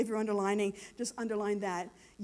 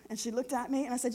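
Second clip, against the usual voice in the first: ordinary delivery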